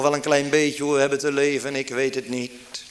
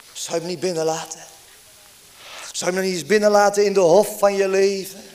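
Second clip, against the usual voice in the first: usual speaking voice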